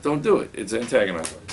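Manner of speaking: ordinary delivery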